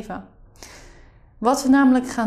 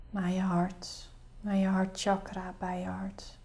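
soft spa whisper; 3 semitones lower, 9 dB quieter than usual. Acted. Leads a guided meditation.

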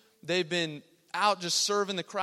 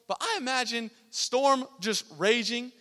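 ordinary talk

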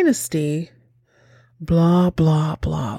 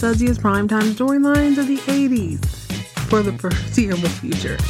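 announcer voice. Acted. Announcer-style show intro by host [name].

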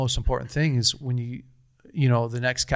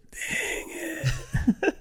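high, whispered laugh through the words; breathy laughing groan, half-joking; laughing dang it; 4 dB quieter than usual. Retold or imitated. Telling the host he'd hoped for rejection, whispered laughing. Glowing review, disappointed joke.

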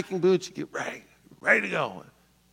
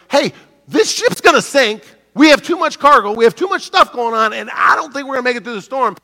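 urgent warning; 7 semitones higher, 7 dB louder than usual. Retold or imitated. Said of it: "Paul says".

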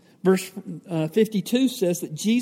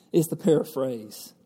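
normal talking voice

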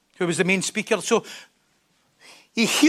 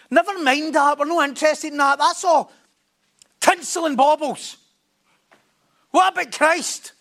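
mock zealous; 5 semitones higher than usual. Imagined zealous blunt reply.

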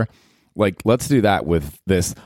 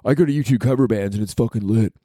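high huffy superfan, mock outrage; 6 semitones higher than usual.

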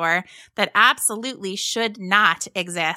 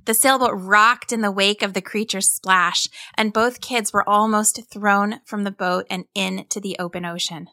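usual speaking voice

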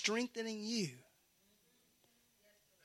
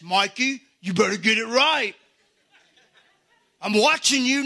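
mock stern warning; 3 dB louder than usual. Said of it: "It's not this".

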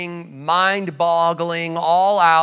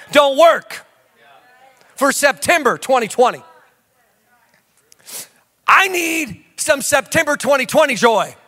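raised, high shouted point; preaching shout; 6 semitones higher than usual. Real, not acted. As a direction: belt it at the congregation.

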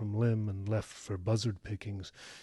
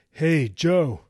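loud, raised hail; calling out; 7 semitones higher, 13 dB louder than usual. A narrator's reading. Wes hailing Joe across the pond, calling out loudly. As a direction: holler a greeting over a distance.

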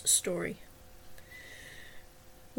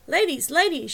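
higher, louder call for attention; urgent call-out; 7 semitones higher, 7 dB louder than usual. A narrator's reading. Marcy calling the women to attention, urgent and raised. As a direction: call out urgently over a crowd. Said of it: "she called out urgently".

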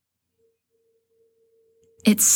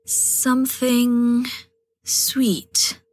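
coy, slow and higher; 5 semitones higher, much slower than usual. A narrator's reading. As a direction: tease it out coyly. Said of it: "she responds coyly".

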